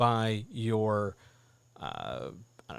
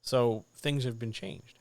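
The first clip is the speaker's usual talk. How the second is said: normal talking voice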